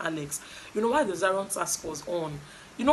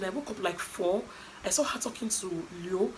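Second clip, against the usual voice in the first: ordinary talk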